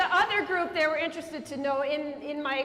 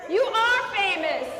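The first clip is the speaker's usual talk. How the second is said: slow, high, louder declaration; mock grand praise; 5 semitones higher, 6 dB louder than usual. Real, not acted. Telling the questioner she's famous, slow and grand. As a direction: announce it like big news to the questioner.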